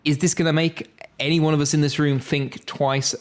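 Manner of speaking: ordinary talk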